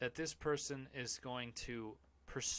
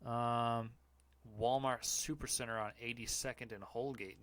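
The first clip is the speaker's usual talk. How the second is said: ordinary delivery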